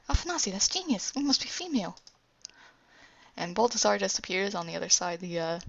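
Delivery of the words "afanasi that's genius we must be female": high-pitched, acted delight; excited realisation. Rurik praising Afanasi's idea about being female, excited. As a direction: exclaim it like a brilliant discovery.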